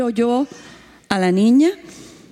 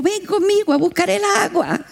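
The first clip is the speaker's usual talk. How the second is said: pastor's wife; 8 semitones higher than usual. Retold or imitated. Pastor's wife voiced in story.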